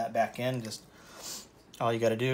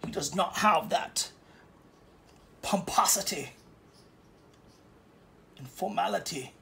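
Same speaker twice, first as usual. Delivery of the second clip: much higher and louder, mock snobbish critic; snooty critic voice; 6 semitones higher, 8 dB louder than usual. Painter imitating a snooty critic who finds him unpolished. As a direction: ham up a snooty art critic. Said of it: "You're like".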